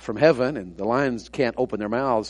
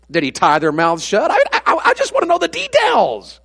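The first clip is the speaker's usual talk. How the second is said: fiery revival preacher, raised and ringing; full-force preaching; 10 semitones higher, 10 dB louder than usual. Acted. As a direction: preach it out to the back pew.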